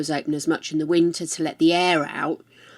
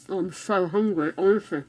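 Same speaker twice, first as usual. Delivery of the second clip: talking through a mouthful; chewing while talking; 4 dB quieter than usual. Real, not acted. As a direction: speak while chewing food. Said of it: "I'm munching and crunching at you".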